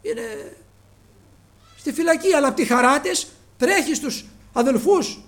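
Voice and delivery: loud, high, impassioned preaching; preaching at full force. Acted. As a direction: thunder the story out to the congregation. Preacher retelling Rhoda and brothers at full force. (κήρυγμα με πάθος, δυνατά)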